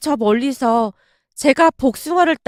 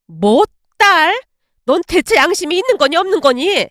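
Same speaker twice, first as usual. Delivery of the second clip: angry outburst; 3 semitones higher than usual. Acted. Mother-in-law scolding daughter-in-law for wanting a girl, angry. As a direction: scold with sudden indignation. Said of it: "엄마가 버럭한 채".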